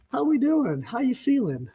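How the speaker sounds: high, breezy pep; mock upbeat check-in. Boss checking on the crew after chaos, breezily high. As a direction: lift it way up, friendly and light. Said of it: "and now we're like".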